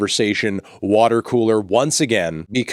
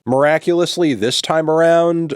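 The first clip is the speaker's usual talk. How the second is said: mock amazement; 6 semitones higher, 3 dB louder than usual.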